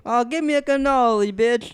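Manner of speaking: high, slow bad Italian accent. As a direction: ham up a mobster Italian accent. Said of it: "the worst accents I've ever heard".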